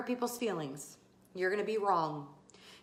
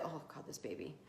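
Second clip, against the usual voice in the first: low, much softer, pained under the breath; strained groan; 7 semitones lower, 17 dB quieter, faster than usual. Real, not acted. Pregnant host to viewers, pained murmur. Baby kicking her ribs.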